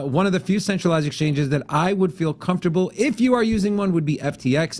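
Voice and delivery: normal talking voice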